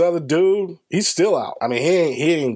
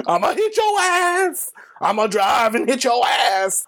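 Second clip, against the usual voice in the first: wild mock threat; 10 semitones higher than usual. Driver chasing a gunman in his car, wildly threatening. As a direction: holler it high, acting out the chase.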